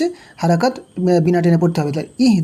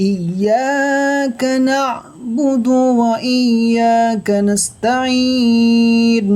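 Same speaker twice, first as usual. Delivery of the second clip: Quran recitation; 2 semitones higher, much slower than usual. Shown as a demonstration. Teacher recites the whole verse for students, slow melodic chant. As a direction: draw each word out in a sung recitation tone. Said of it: "কী হবে?".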